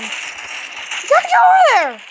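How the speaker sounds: high, drawn-out urging yell; urgent call. Real, not acted. Player urging a move over there, urgent high call.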